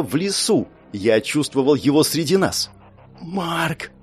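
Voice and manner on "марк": soft frightened whisper. A narrator's reading. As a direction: whisper his name. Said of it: "прошептала Алиса".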